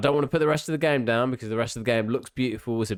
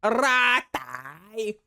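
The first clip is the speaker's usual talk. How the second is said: mock accent; 7 semitones higher, much slower than usual. Mocking the game character's accent.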